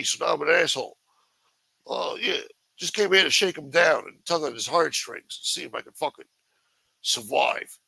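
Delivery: high, whiny mocking impression of the moocher; mock drifter voice. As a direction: play the pathetic guy sweet-talking old acquaintances. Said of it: "Driving up to random people's houses like".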